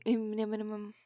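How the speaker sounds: plain reading voice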